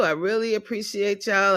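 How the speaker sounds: usual speaking voice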